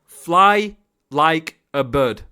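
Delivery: very slow, word by word; slow emphatic repeat. Real, not acted. Coach drumming an instruction into the player, slow and emphatic. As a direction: draw out each word, with gaps between.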